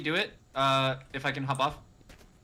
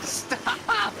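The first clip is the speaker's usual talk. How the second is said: shriek. Real, not acted. Shrieking through laughter.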